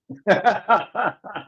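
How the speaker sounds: high burst of laughter; laughing. Real, not acted. Laughs at own bias remark.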